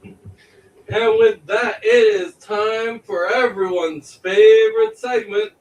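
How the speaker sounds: slow, drawn-out show announcer. Acted. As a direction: build up the segment like a host. Announces the next segment.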